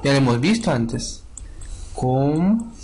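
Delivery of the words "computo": syllable by syllable with very long gaps; spelling-out dictation, extremely slow; sounded out. Shown as a demonstration. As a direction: draw out each syllable of "Computo", pausing long between. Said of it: "¿Cómo se escribe computer?".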